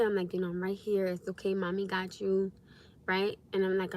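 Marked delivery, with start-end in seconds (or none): 0.5-2.5 put-on soft, hushed reassurance to a frightened child; calm him down gently; soothing mother. Mother soothing seizing son.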